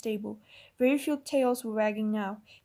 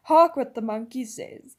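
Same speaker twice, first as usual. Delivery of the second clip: loud, slow jeering shout; 8 dB louder, slower than usual. A narrator's reading. Griffel shouts at the crowd, jeering loudly. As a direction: call it out across the fire, mocking. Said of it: "it shouted".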